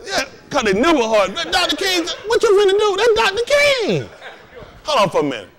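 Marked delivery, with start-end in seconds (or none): 1.3-4.1 hammed-up adoring church lady; awestruck fan. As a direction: gush over him like a smitten fan. Adoring riff on Doctor King.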